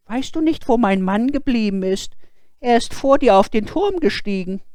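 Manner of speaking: high, loud worried woman's voice; a frightened wife asking after her husband; anxious wife. Acted.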